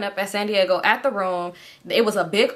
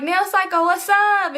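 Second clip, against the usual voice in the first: cheery greeting; 11 semitones higher, 3 dB louder, slower than usual. Retold or imitated. Greeting friend on phone.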